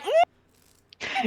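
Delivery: short, high, soft hum; reluctant "mm". Acted. Player voicing Amelia's reaction, high soft hum. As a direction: squeak out a small high "mm". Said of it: "Amelia is like".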